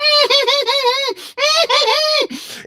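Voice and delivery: high piercing cry of pain; pained wailing scream; agonised scream. Acted. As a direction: scream as thumbs are cut. Tommy screams as thumbs cut.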